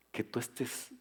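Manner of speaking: ordinary delivery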